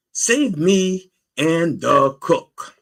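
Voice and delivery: slow, high, loud begging; drawn-out imploring cry; emphatic plea. Acted. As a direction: spell out the plea slowly and loudly.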